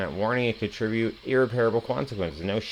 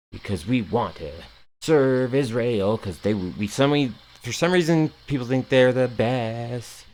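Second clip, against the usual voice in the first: sarcastic singsong; 3 semitones higher, 4 dB louder than usual. Acted. Streamer mocking pro-war reasoning, sarcastic singsong. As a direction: draw it out sarcastically, half-sung. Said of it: "sarcastic there".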